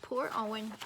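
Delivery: ordinary delivery, nothing marked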